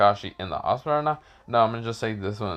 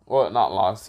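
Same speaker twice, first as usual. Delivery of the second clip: usual speaking voice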